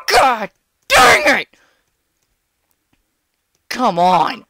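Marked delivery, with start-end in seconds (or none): 0.1-1.5 high, loud, slow wail; real frustrated cry. Real, not acted. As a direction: wail it at the screen. Frustrated at loss.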